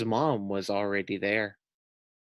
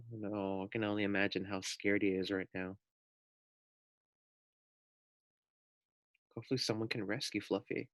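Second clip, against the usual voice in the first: much softer, fast worried aside; hushed worry; 3 semitones lower, 12 dB quieter, faster than usual. Real, not acted. Teacher worrying about the pig to the class, hushed. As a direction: murmur it under your breath.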